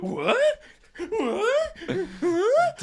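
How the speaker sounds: very high, climbing What; outraged squeak. Retold or imitated. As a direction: squeal in disbelief, laughing. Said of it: "And he went".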